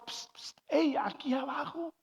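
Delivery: whispered psst, then a high hey; mysterious voice from below. Acted. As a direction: call up furtively for attention. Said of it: "oía otra voz".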